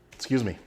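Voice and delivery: real cough, then excuse me; sudden cough from the spice. Real, not acted. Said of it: "I am coughing away".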